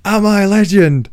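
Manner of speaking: panicked correction; flustered fumble. Pretend. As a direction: catch yourself mid-line. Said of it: "he just goes".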